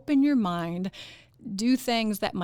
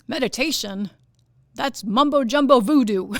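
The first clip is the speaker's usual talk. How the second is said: much louder, scornful mimicry; loud mock scoff; 3 semitones higher, 9 dB louder than usual. Host imitating a workshop guy dismissing meditation, loud mock scoff. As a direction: imitate a dismissive sceptic, booming.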